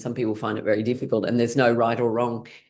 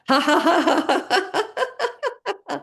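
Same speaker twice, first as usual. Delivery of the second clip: big laugh; laughing tantrum. Shown as a demonstration. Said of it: "a laughing tantrum".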